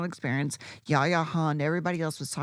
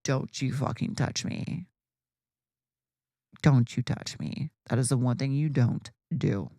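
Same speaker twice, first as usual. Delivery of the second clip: cold threat; 5 semitones lower than usual. Retold or imitated. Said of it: "And I was like".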